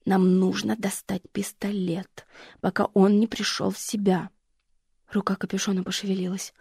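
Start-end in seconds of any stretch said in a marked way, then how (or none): 0.1-4.3 медленный беззвучный шёпот; шепни, чтобы он не услышал; напряжённый шёпот. Acted. Лора торопит шёпотом. (мать шепчет дочери над бандитом, медленный шёпот)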